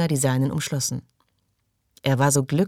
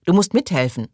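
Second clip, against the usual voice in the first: louder, insistent call; calling after her; 3 semitones higher, 5 dB louder than usual. A narrator's reading. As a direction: press her from a distance. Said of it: "rief Belznur".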